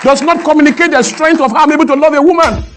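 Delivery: impassioned oratory. Real, not acted. Speaker protesting being judged on grammar, impassioned and insistent. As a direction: drive each phrase home to a cheering crowd.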